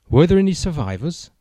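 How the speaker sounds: high, loud, breathless question; gasping. Acted. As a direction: gasp it out in alarm. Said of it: "the agent gasped".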